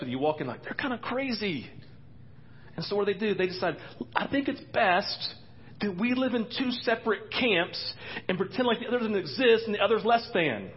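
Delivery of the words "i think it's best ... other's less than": mock smug church voice. Mocking the divided church camps.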